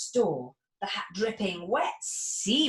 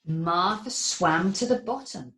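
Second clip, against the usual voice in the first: usual speaking voice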